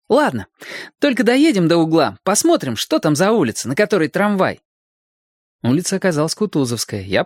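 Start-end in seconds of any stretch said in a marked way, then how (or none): 0.1-4.6 put-on high, lively young friend; eager boyish suggestion in a higher voice; agreeable boy. A narrator's reading. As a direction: agree readily, voice pitched well up. Friend's reply voiced in story.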